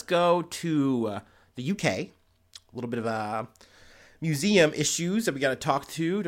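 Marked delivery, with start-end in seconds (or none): 2.7-3.4 talking around a burp; swallowing a burp mid-sentence; holding back a burp. Real, not acted. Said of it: "Sorry, I had a burp I had to get through".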